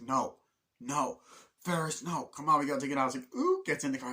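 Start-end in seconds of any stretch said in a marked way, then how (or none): 0.0-3.1 higher, louder, panicky protest; mock-panicked pleading; pleading friend. 3.3-3.6 very high delighted ooh; high gleeful squeal; excited squeal. Retold or imitated. Excited squeal getting in car.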